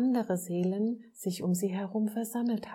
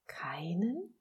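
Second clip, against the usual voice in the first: softly incredulous, sharply rising tune; disbelieving question; 3 dB quieter than usual. A narrator's reading. Little soul can hardly believe what God says, incredulous rising question. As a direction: ask KEINEN with the pitch climbing in disbelief. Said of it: "konnte kaum glauben, was Gott da sagte".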